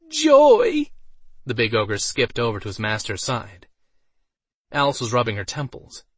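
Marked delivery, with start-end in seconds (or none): 0.1-0.9 very high, loud delighted cry; joyful squeal. A narrator's reading. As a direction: squeal with pure joy. Ogre delighted.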